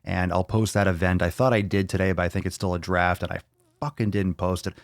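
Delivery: normal talking voice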